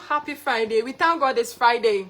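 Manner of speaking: ordinary talk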